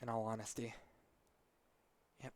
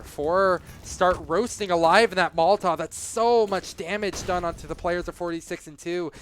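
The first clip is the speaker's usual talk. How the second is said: excited; 3 semitones higher, 8 dB louder than usual. Real, not acted. Big Molotov damage moment.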